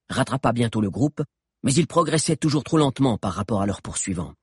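ordinary delivery

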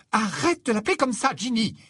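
sharp reply. Acted. Mother scolds Ginny for the nickname, curt and high. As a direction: cut her off with a dry rebuke. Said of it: "répliqua sèchement Mrs Westley".

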